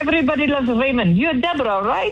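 excited Indian fan. Retold or imitated. Fan from India running up on a London street, thrilled and high. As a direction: play a starstruck fan spotting Deborah outside a shop. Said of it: "a woman running up to me, uh, from India and saying".

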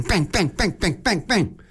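bang bang said as little pops; playful pop sounds; popping noises. Acted. Popping inflatable school with pin.

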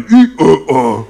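short loud mock grunts; imitated stage noise. Comedian imitates stagehands making the sound effect.

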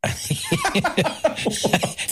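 a shy, high little laugh; high giggle. Retold or imitated. [name]'s laugh imitated.

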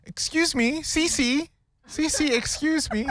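put-on high, childlike English-accented call; a parent mocking their child at a restaurant; imitating a child. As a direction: mimic a kid politely calling a waitress. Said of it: "and saying".

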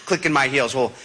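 ordinary talk, nothing marked

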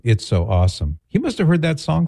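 ordinary talk, nothing marked

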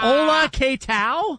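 high, slow put-on Spanish accent; mock Spanish greeting. Host joining the teasing with a high mock Spanish greeting.

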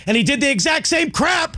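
worked-up sports-fan gripe; real irritation. Real, not acted. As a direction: rant in exasperation, pitch jumping up. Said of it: "why am I this angry".

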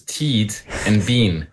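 "Teed and Bean" with the short e stretched into a long ee; Kiwi pronunciation. Retold or imitated. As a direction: say "Teed and Bean" the way a New Zealander says Ted and Ben. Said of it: "your impression of what your names sound like in a Kiwi accent".

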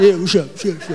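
low, tuned-out mumbling; half listening, just nodding along; bored nodding man. Retold or imitated. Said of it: "start nodding".

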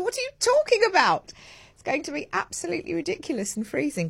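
mock self-scolding. Host voicing her sensible self against the pool idea. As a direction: scoff at yourself in disbelief.

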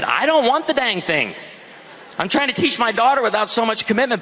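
high-pitched retold exasperation; exasperated protest. Dad objects to the sales associate about a guinea pig, exasperated. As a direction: protest comically, pitched well up.

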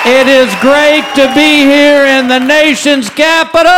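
loud, high, drawn-out shout; shouted crowd greeting. Real, not acted. Comedian to the audience on arriving in the capital, shouted greeting. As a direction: holler it out slowly to the whole crowd.

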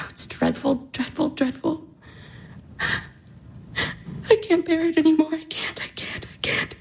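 sighing, whispered anguish; fading into a broken whisper; despairing whisper. Acted. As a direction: whisper her despair, barely audible. Irina breaking down in despair.

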